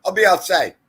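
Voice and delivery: high, loud announcement; retold calling out. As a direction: imitate someone calling out as they leave. Retold grandfather walking out of movie.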